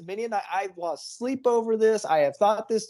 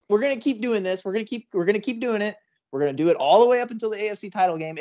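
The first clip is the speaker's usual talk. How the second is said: fast; 3 semitones higher, much faster than usual. Real, not acted. Rapid rant.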